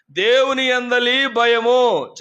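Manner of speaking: slow, stressed on దేవుని; emphatic call phrase. Shown as a demonstration. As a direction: lead the phrase for the crowd to repeat, slow. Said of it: "చెప్పండి".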